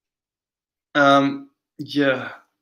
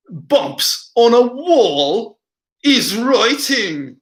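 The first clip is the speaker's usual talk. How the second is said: high, drawn-out mock Yorkshire accent; 5 semitones higher, much slower than usual. Streamer mocking a video maker's verdict, slow Yorkshire accent. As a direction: stretch every word in a broad Yorkshire impression. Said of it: "the Yorkshire accent".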